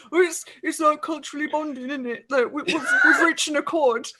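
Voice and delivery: high ogre voice breaking into laughter; giggly ogre. Acted. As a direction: chatter like a pleased, simple ogre. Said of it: "The ogre says".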